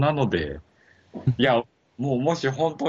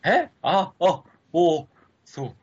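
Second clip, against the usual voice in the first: ordinary talk, nothing marked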